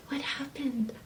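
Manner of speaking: breathless whispered question; wondering whisper. Acted. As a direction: whisper it in awe.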